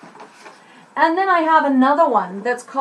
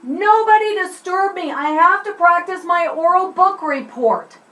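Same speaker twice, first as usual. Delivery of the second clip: calling offstage; 6 semitones higher than usual. Shown as a demonstration. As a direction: project it over your shoulder at the wings. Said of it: "it might look something like this".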